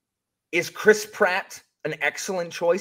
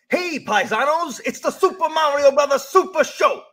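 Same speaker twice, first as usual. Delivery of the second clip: quoting show intro; 7 semitones higher, 3 dB louder than usual. Retold or imitated. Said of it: "you remember".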